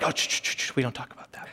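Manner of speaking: whispered hushing, dropping to near silence. Retold or imitated. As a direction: shush them in a hurried whisper. Relative hushing at a reunion.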